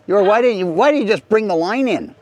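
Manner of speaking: half-joking outburst of amazement; excited protest. Real, not acted. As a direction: demand it, voice jumping high.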